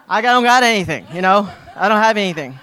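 high, loud, laughing outburst; loud joking protest. Real, not acted. Unprepared speaker to the congregation, loud laughing protest. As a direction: burst out loudly with a laugh. Joking he has nothing prepared.

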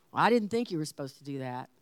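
low, quick, casual aside; offhand passing remark. Retold or imitated. Husband mentioning doubts in conversation, offhand. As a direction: toss it off like a passing thought. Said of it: "It just sounded like a passing thought to me".

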